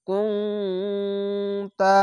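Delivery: কুনতা with a long nasal hum on the ন, very slowly; nasal ghunna. Shown as a demonstration. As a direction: hum the ন through the nose. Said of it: "এখানে ইকফা গুন্না". (গুন্না দিয়ে ধীরে পড়া)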